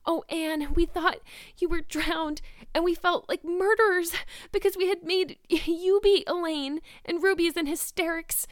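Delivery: put-on a girl near tears with relief and joy; high, loud tearful relief; weeping with relief. A narrator's reading. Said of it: "weeping with relief and delight".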